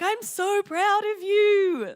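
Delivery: high, loud and drawn-out gushing; happy, gushing pride. Retold or imitated. Cheering on a friend who stood up, proud. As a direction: stretch it out, bursting with pride. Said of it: "I was happy".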